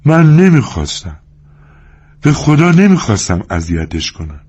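mild tearful voice; crying. Acted. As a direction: plead through tears. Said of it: "لنی گریان گفت".

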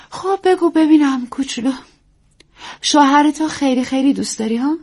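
high, teasing coax. Acted. Actress coaxes young wife.

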